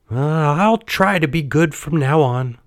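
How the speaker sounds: put-on high, childlike puppet voice; high-pitched hesitant pledge; Pinocchio. A narrator's reading. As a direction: promise like a little boy. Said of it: "said Pinocchio".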